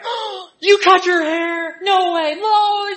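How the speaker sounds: squealing high and loud, slow and drawn out; going crazy. Retold or imitated. Cassie seeing the wife's new haircut, shrieking excitement. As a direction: gush over the haircut in delighted disbelief. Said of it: "She's going crazy".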